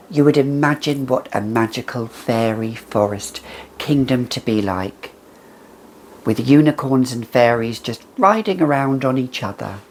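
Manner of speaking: ordinary delivery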